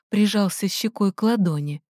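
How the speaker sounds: usual speaking voice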